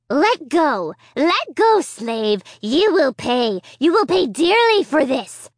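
high, loud screaming puppet voice; screaming dummy. A narrator's reading. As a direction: scream threats like an evil dummy. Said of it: "he screamed and protested".

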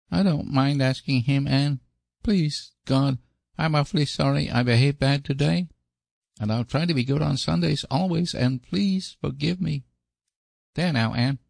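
put-on high, earnest child prayer; little boy praying. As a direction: pray like a sorry small boy. Davy says his prayer.

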